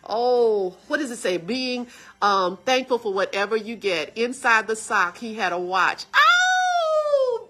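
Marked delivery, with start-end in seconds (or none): 6.2-7.5 high, loud cry of emotion; tearful cry. Real, not acted. Daughter remembering her brother's hidden watch, crying out. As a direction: let out a moved, tearful cry. Moved recalling the watch in the sock.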